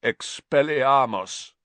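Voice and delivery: slow, croaking cast of the spell; croaked spell. Acted. Escaped prisoner disarming the children, croaking. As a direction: rasp the spell out like a man long silent. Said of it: "he croaked".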